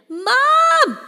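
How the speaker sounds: piercing, very high loud shriek; dragged away, shrieking for help. A narrator's reading. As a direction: shriek for your mother at full force. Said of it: "I shriek".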